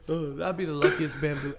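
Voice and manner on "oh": usual speaking voice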